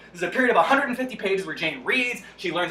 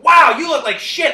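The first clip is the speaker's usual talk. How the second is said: mock disgusted exclamation; 6 semitones higher, 9 dB louder than usual. Every character insults Jane.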